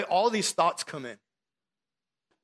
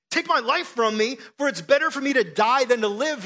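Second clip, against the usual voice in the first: higher, louder desperate cry; anguished outcry; 8 semitones higher, 7 dB louder than usual. Acted. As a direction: throw the plea up louder and higher. Jonah pleading, repeated louder.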